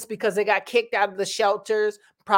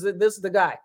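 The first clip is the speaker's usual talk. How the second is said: ordinary talk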